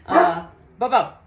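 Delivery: calling the dog. Real, not acted. Calling the dog during a delivery.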